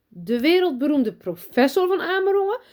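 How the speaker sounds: high, loud, starstruck gush. Acted. Teacher gushing at the famous father, excited. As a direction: swoon over his fame.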